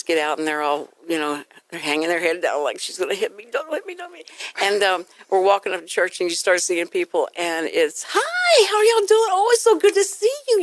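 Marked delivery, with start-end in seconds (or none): 2.8-4.3 soft little plea, rising higher; mock cowering child. Parent voices cowed children. 8.1-10.6 very high, loud, sugary hello; gushing greeting. Retold or imitated. Switches to gushing church greeting.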